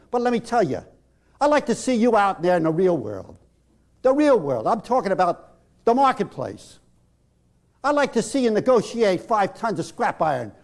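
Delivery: loud, mocking angry tirade; sarcastic tirade. Rob turning on timid Vicky, loud and mocking. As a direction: tear into her with sarcasm. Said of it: "Rob turns on Vicky".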